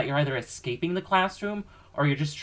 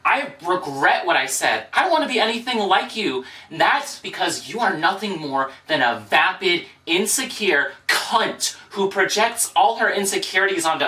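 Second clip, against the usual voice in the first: loud, higher furious tirade; angry rant; 3 semitones higher, 5 dB louder than usual. Acted. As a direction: finally snap and tell her off. Furious rant against alter ego.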